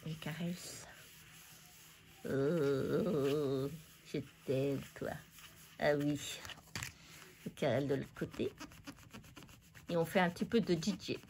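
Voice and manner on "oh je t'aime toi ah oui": much softer, lower loving coo; tender mock lovey coo. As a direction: whisper sweet nothings to the paper. Cooing at the paper.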